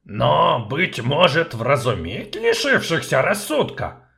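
loud, indignant dwarf voice. Acted. Dwarf outraged at the sorceress. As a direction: bristle with outrage. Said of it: "возмутился гном".

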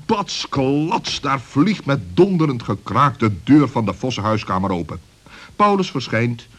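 ordinary delivery, nothing marked

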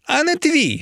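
very high-pitched, fierce snap back; fiercer menacing retort. A narrator's reading. Emiliia pressed by her rival, fiercer menacing retort. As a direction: cut in with a high, heated threat. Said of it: "ще грізніше втяла Емілія".

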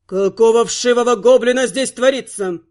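booming bass of an irate king, lightly acted; Vongard's bass voice. A narrator's reading. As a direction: put on a bass royal voice. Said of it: "басовитый голос их величества Вонгарда". (басовитый голос недовольного короля)

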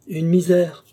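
loud, high, brisk declaration; breezy assertion. A narrator's reading. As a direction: toss it out emphatically as a trifle. Offhand answer, later echoed ironically. (affirmation forte et désinvolte)

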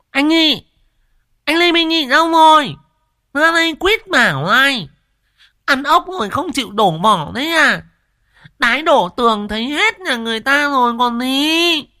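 high, loud hollering village woman; loud brash woman. Acted. Quýt Nẫu yelling at the gate for Nhị, high and booming. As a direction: bellow like a loudspeaker. Cái giọng của nó thì to như loa công suất.

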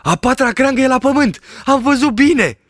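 high, loud, urgent; excited shepherd. A narrator's reading. Shepherd sees the fallen branch, loud and excited. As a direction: cry it out in alarm.

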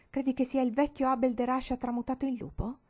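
ordinary delivery

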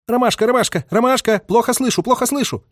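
high-pitched chanting call sign; high, rhythmic signalman's calling; radio recitative. A narrator's reading. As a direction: repeat it like an insistent radio operator. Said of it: "речитативом доносился голос радиста".

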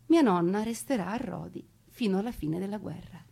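normal talking voice

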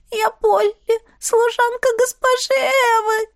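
high, wailing maid's voice; tearful confession. A narrator's reading. Sobbing maid confesses who she is.

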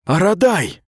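sudden high cry of recognition; surprised cry. Acted. Mita recognises the commander, crying out high. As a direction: blurt his name out in shock. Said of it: "вскрикнула Мита".

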